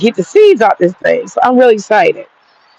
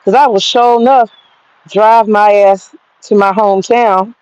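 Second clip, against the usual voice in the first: ordinary talk, nothing marked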